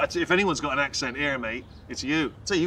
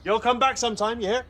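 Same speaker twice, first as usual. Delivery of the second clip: put-on Texan; 8 semitones higher, 4 dB louder than usual. Briton posing as Dallas-born bids goodbye, put-on Texan. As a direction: overdo a Southern send-off.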